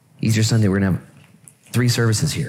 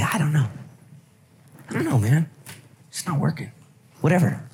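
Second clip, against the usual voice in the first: frustrated shrug; 7 semitones higher, faster than usual. Acted. Struggling churchgoer admitting it's not working, higher and defeated. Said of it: "you can be honest and say".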